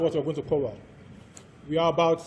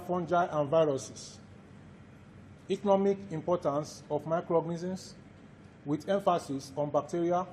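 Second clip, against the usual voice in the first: dictation. Acted. Lecturer dictates course outline.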